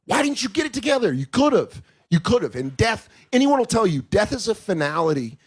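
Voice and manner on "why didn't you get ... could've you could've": high, pleading frustration at a dead father; crying out why he didn't fix it, raised; frustrated plea. Retold or imitated. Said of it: "'Cause you're like".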